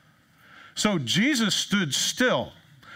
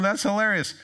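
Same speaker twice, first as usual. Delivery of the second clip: amused guest; 5 semitones higher than usual. Acted.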